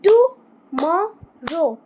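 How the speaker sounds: Tomorrow drawn out slowly syllable by syllable; slow syllable demo. Shown as a demonstration. Teacher to pupils, slowly spelling out syllables. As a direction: stretch tomorrow into slow beats. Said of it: "फिर क्या है".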